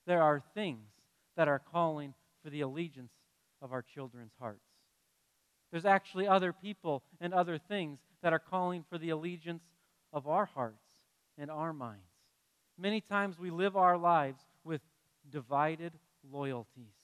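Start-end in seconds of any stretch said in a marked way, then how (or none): 0.1-12.0 earnest preaching. Real, not acted. Preaching the serious point.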